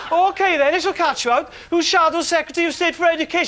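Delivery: put-on high, breathy-free girlish question.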